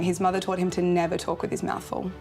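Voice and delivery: ordinary talk, nothing marked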